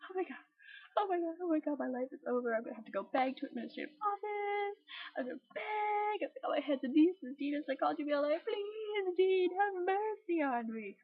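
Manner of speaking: high, hammed-up panicked crying; tearful wailing. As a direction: plead melodramatically, as if bawling. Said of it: "I burst into tears".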